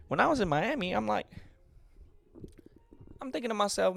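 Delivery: put-on high, loud Miami accent. Storyteller explaining his party mindset, hammed-up Miami accent. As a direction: play up a pushy Miami accent. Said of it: "I don't know what I'm doing the accent".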